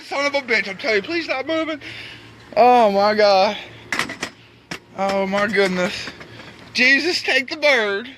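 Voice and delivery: ordinary talk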